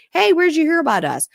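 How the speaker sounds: high, loud friendly query; seller's quoted question. Acted. Company asks buyers where they heard, high quoted question. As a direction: pipe up brightly with the question.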